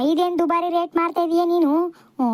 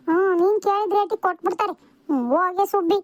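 normal talking voice

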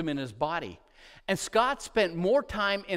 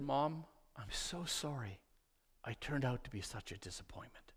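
put-on tearful little boy; 5 semitones lower, 14 dB quieter than usual. Sick boy telling his mom he's a disappointment, tearful and hushed. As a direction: barely get the words out through tears. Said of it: "tears just streaming down his face".

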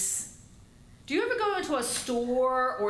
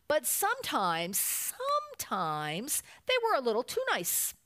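put-on lively read-aloud for children; storybook narration; 3 semitones higher than usual. A narrator's reading. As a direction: read a picture book with lilting emphasis. Reads a picture book to children.

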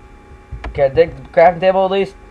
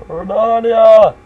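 drawn-out shout; 6 semitones higher, 4 dB louder, much slower than usual. Acted. Drawn-out yell.

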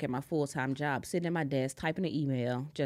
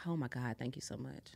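emotional thanks; 5 semitones lower, 10 dB quieter than usual. Retold or imitated. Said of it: "I think I started crying".

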